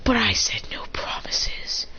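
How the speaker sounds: slow unvoiced whisper; chilling slow whisper; menacing whisper. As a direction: breathe the threat slowly. Threat with kunai at throat.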